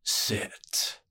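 a soft, menacing one-word order; cheerful, dangerous command. Acted. Crime boss ordering the thief to sit, softly menacing. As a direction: pleasant on the surface, threat underneath, barely voiced. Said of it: "in a voice that was cheerful and dangerous".

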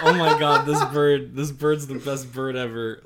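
breathless excited gushing; gushing delight. Retold or imitated. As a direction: gush over the bird like a giddy fan. Gushing over the new pet.

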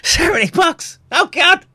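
high, loud joyful outburst; excited shout. Real, not acted. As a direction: shout it out in delight. Donation goal nearly reached.

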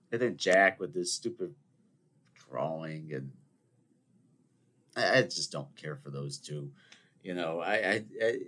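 ordinary talk

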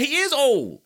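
high, loud exasperated insistence; exasperated outburst. Real, not acted. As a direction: hammer it home, stretching the repeat slowly. Exasperated rant at LeBron's defenders.

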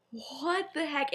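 drawn-out disbelief, imitating someone lost for words; at a loss. Remus reacting to Lily's ignorance, at a loss. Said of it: "has no idea what to say".

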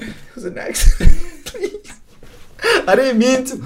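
high, laughing half-whispered plea. Retold or imitated. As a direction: beg through laughter to the cop. Pleading with cop over crash.

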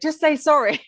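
lightly acted exasperated demand; insistent demand. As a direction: imitate someone pressing for an apology. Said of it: "When he tries to make Jez apologise".

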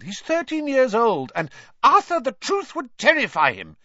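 very high, loud shrill plea. A narrator's reading. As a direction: shriek your fear for him. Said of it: "said Missus Weasley shrilly".

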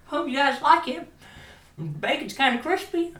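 very high, loud imitation of the wife; put-on wife voice. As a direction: squeak it out like a hammed-up hostess. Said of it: "I don't know why I use my accent for".

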